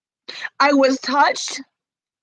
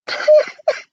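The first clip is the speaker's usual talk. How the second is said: high-pitched real sob; wordless burst of crying; 13 semitones higher, 3 dB louder than usual. Real, not acted. Breaks down crying mid-sentence.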